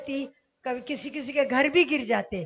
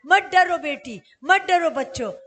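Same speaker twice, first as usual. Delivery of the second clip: raised, louder voice of divine reassurance; emphatic reassurance; 3 semitones higher, 7 dB louder than usual. Retold or imitated. The Lord answering the believers as children, emphatic reassurance. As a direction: urge them firmly not to fear. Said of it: "यही जवाब वो दे रहा".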